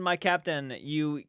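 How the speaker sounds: ordinary delivery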